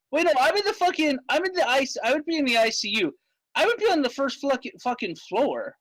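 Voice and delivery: high-pitched, insistent objection; indignant protest. Real, not acted. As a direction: argue your case, pitch jumping way up. Player argues about which floor.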